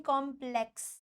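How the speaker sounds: कॉम्प्लेक्स softly split as "kom... plex"; careful syllables. Shown as a demonstration. Teacher models the right कॉम्प्लेक्स, soft and syllable by syllable. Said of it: "कॉम्प्लेक्स नहीं".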